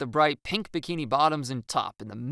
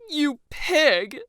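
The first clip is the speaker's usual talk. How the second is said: higher, louder hammed-up insult; mock outrage; 7 dB louder than usual. Staged drama quarrel.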